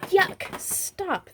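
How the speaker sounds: high squealing protest. A narrator's reading. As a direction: squeal at the splash of mud. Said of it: "they squealed".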